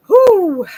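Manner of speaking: loud, high exhale of relief; shaky release after holding back tears; relieved whew. Real, not acted. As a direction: blow out the emotion with a big whew. Relief after emotional reading.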